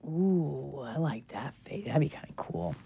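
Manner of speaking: low private whisper to self. Real, not acted. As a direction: whisper it, thinking aloud. Murmuring to self over haircut.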